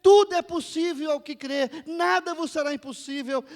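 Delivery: high, very loud divine declaration; booming, impassioned proclamation; full-force preaching. Acted. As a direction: thunder out God's promise to the crowd. Voices father and Jesus, preaching.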